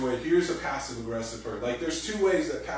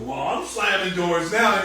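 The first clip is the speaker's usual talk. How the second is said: slow, louder spiteful comeback; mock door-slammer retort; 8 semitones higher, 9 dB louder, slower than usual. Spouse hitting back in a door-slamming fight, mock angry. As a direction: snap back at the partner, dragging it out. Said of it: "You're like".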